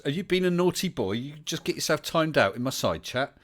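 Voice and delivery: high, loud teasing telling-off; playful scolding. Real, not acted. Host ribbing his guest over a time-out, playful scolding.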